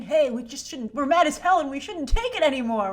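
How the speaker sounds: usual speaking voice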